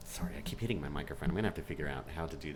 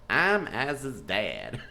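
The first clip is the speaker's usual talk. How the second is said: very slow, drawn-out East Texas drawl; East Texas accent; 3 semitones higher, 4 dB louder, much slower than usual. Retold or imitated. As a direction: imitate dad's East Texas accent, amused. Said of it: "he's got an East Texas accent".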